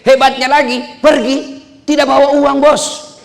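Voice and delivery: high, loud preacher's build to the word Ilmu; rousing excited shout. Acted. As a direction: fire up the crowd with a big build. Rousing punchline to the crowd.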